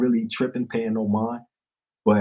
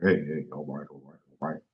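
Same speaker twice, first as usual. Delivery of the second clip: mumbled nervous greeting; 7 semitones lower, 7 dB quieter than usual. Retold or imitated. Nervous snitch avoiding eye contact.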